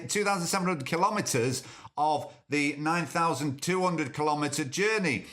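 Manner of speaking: ordinary talk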